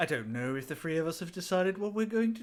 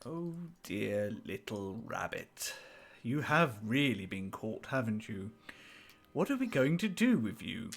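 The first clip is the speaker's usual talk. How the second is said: playful menace; 4 semitones lower, slower than usual. Acted. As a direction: purr over the helpless prey. Toying with captive as snake.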